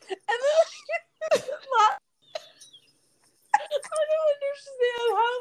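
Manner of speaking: high, breathless laugh-crying; can barely speak through the laughter; real laughing fit, words squeezed out high. Real, not acted. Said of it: "I'm literally crying".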